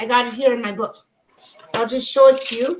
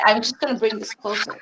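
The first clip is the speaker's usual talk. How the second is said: ordinary talk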